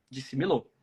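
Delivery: ordinary talk, nothing marked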